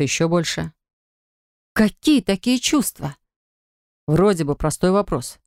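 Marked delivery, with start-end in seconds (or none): 1.7-3.2 raised, wondering question; can't believe her ears; stunned, higher and slow. A narrator's reading. She is stunned.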